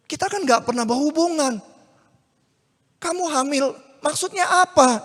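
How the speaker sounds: high, loud disbelieving demand; bewildered protest. Acted. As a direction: push back, not understanding. Yusuf confused, accusing. (Yusuf bingung mendesak Maria, suara tinggi)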